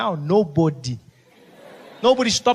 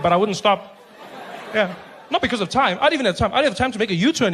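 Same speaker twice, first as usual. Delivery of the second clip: ordinary delivery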